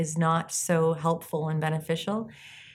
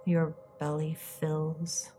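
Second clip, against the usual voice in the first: soft spa whisper; 7 dB quieter than usual. Acted. Leads a guided meditation.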